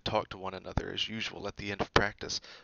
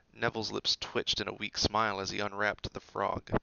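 ordinary delivery

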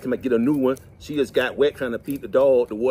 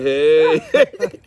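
flirty hey; 2 semitones higher, 8 dB louder than usual. Retold or imitated. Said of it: "When she said".

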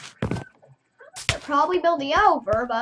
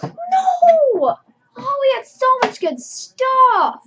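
loud, high wail of loss; dismayed outcry; 10 semitones higher, 8 dB louder, much slower than usual. Real, not acted. Player mourning lost gear after dying, dismayed outcry. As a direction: cry out long and high in dismay.

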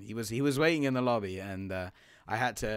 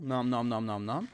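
playful nom nom; munch the apple sounds; eating noises; 4 dB louder than usual. Pretend. Eating an apple in game, playful chomping noises. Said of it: "Eat this apple".

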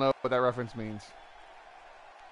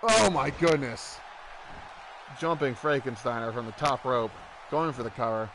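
excited play-by-play call; wrestling commentator; 3 dB louder than usual. Acted. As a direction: call the match like ringside. Play-by-play of the match.

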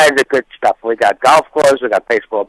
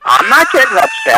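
defensive denial; 10 semitones higher, 3 dB louder than usual. Real, not acted. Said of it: "Don't get all upset about it".

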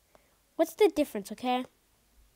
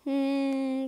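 ordinary delivery

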